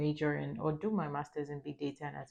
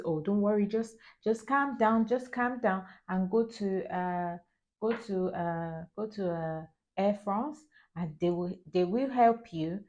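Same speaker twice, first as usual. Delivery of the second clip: put-on higher-pitched French accent, reassuring; imitated French-accented English; 4 semitones higher than usual. Airport staffer calming a panicked traveller, imitated French accent. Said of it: "that's how they kind of sound when they're speaking English".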